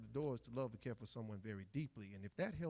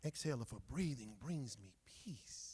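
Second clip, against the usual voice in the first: hushed unvoiced whisper; 4 semitones lower than usual. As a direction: whisper so as not to wake her. Poem about watching her sleep.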